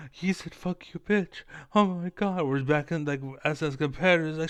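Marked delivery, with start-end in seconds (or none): 0.1-2.4 higher, louder, outraged fan voice; shocked gossip voice, hammed up; mock scandalized fan. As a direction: parody a fan clutching pearls. Mocking offended audience.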